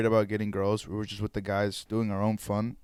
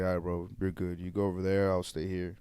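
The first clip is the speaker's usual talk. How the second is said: calm backing down; 5 semitones lower, 8 dB quieter than usual. Retold or imitated. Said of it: "I was like".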